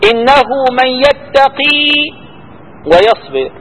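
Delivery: a light Quranic recitation; verse recitation. Acted. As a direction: recite it like a reading of scripture. Teacher quotes a Quran verse.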